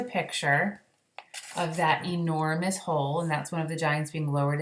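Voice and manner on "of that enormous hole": usual speaking voice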